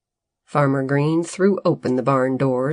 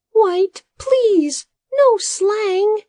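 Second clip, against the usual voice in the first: high, louder pleading little voice; pleading Bodo; 5 dB louder, much slower than usual. A narrator's reading. Assistant begging the farmer over his robots' orders, high pleading voice. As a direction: beg him in a high voice. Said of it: "Bodo pleaded".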